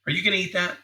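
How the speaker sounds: hinting, hopeful ask. Shown as a demonstration. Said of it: "notice the tone of my voice".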